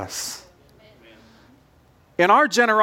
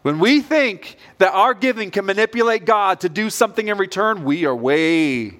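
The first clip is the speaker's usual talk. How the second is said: ordinary talk, nothing marked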